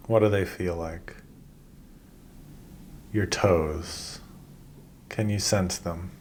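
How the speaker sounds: calm, unhurried body-scan guidance with long pauses; measured meditation-teacher voice, leaving space after each instruction; guided meditation. Acted. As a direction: guide them gently through breath and body, phrase by phrase.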